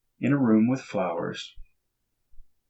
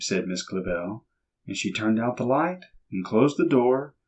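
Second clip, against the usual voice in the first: ordinary delivery, nothing marked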